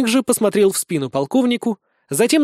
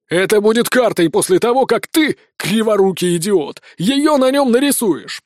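angry berating; 3 semitones higher than usual. Acted. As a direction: chew out a useless subordinate. Colonel berates assistant, insulting him. (гневный разнос)

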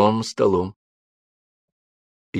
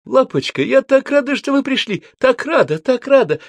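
very high, loud squeal of delight; squealing with joy; 4 dB louder than usual. A narrator's reading. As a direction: shriek happily while rushing to hug. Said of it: "с криком бросилась к Римме".

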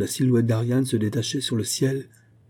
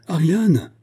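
high, loud falling call; 8 semitones higher, 6 dB louder than usual. A narrator's reading. Olga calls the missing Ariane, crying out. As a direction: cry out her name. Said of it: "cria Olga".

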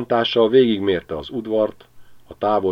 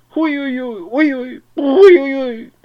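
a hurt, angry dog's yowl, far higher; offended dog howl; 9 dB louder, slower than usual. A narrator's reading. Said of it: "üvöltött Vahur részint megsértve". (sértett, dühös kutyaüvöltés)

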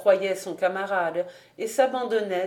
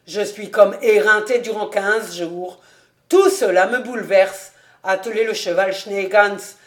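put-on louder, grumbling old official; gruff collector; 6 dB louder than usual. A narrator's reading. Han refusing dinner from the innkeeper, grumbling loud. Han's voice voiced loudly.

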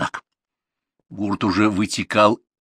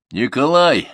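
raised, loud call of a name; calling after someone; 6 semitones higher, 5 dB louder, slower than usual. A narrator's reading. Brigadier following the rider, calling out loudly. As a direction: call out to a rider moving away. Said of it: "пошёл вслед всаднику бригадир".

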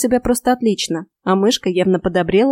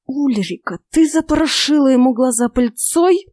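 tense, angry questioning; seething reproach, voiced rather than whispered; angry hiss; 5 semitones higher than usual. A narrator's reading. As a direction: hiss the accusation at her. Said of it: "Злым свистящим шёпотом".

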